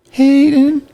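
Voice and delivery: high, loud call of a name; sudden high-pitched call. Real, not acted.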